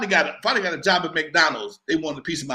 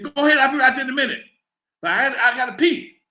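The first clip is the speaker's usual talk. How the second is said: fast, higher and louder; hurried excuse; 7 semitones higher, 6 dB louder than usual. Retold or imitated. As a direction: rush out an excuse to stall. Stalling excuse.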